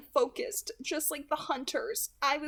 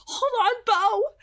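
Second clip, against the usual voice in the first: higher and louder, begging him to hold on; urgent plea; 11 semitones higher, 9 dB louder, slower than usual. Acted. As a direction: plead with desperate urgency. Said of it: "Edith pleaded".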